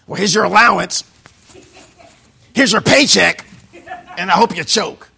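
loud, grudging handover; resentful boss. Pretend. Boss handing over a paycheck with spite. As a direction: sneer as you hand it over.

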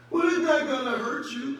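loud, high, breezy coworker voice; coworker inviting. Retold or imitated. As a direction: toss out a cheery invite. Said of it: "coworker urging".

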